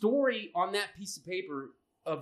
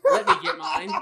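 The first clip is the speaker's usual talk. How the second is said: high laughing plea, louder; 10 semitones higher, 6 dB louder than usual. Retold or imitated. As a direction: beg through laughter. Praying, laughing.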